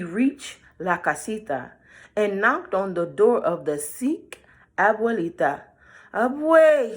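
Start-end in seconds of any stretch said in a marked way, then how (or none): 6.1-6.9 wolf in a high, loud squeak; high squeaky voice. A narrator's reading. Wolf fooling grandma, high squeaky voice. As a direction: put on a fake squeaky voice. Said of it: "he said in a high squeaky voice".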